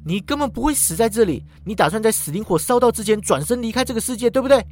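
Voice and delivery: 指责的语气; accusing. Acted. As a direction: pin him with the charge. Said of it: "语气指责".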